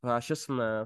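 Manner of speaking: normal talking voice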